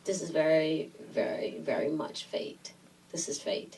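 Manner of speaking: low, hushed imitation; solemn pronouncement. Director declaring their meeting destiny, low and hushed. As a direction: sink into a soft, portentous murmur.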